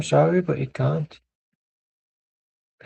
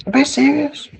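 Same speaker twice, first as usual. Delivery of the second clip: high squeal; 11 semitones higher, 5 dB louder than usual. Acted. Mock serious pundit.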